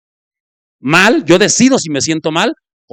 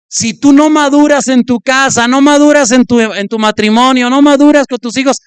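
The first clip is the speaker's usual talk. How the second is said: rising preaching; 7 semitones higher than usual. Real, not acted. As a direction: drive it home to the congregation. Preacher building to exhortation. (predicando con fuerza, más alto y más fuerte)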